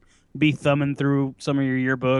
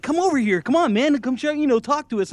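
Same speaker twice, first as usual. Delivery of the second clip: friend calling him over; 10 semitones higher than usual. Retold or imitated. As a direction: play Tanner waving him over, high and eager. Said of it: "Tanner was like".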